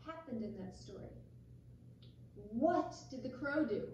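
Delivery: usual speaking voice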